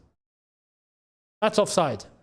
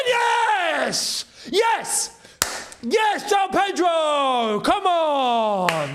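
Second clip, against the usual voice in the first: goal celebration; 7 semitones higher, much slower than usual. Real, not acted. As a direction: scream yes at the goal. Chelsea score a goal.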